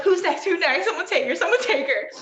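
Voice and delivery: high, loud imitation of begging to tag out; frantic mock plea. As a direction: call for backup in pretend panic.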